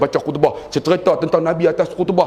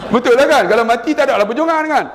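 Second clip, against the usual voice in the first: excited outburst; 11 semitones higher than usual. Real, not acted. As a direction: burst out to the crowd. Excited aside to the audience. (seruan penuh semangat)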